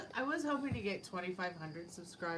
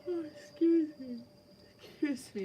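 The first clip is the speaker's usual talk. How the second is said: yawning; 8 semitones higher, 5 dB louder than usual. Real, not acted. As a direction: talk right through a real yawn. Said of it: "I'm yawning".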